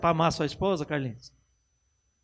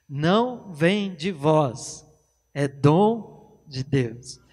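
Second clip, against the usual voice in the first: very slow, lower, each word weighed; slow emphatic verse; 4 semitones lower, much slower than usual. Shown as a demonstration. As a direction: spell out "dom de Deus" slowly. Preacher repeating the verse.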